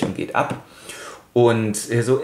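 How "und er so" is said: usual speaking voice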